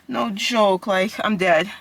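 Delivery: ordinary talk, nothing marked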